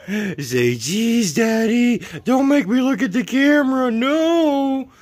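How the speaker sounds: high, loud squeaky voice for the dog; squeaky pet voice. Acted. Playfully voices the dog to daddy.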